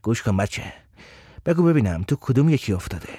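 low, soft man's voice; calm questioning man. A narrator's reading. Abbas asking the boy which one he fell in, low and calm.

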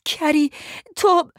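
high, alarmed exclamation. Acted. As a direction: call out in rising panic. Horrified at friend's injury.

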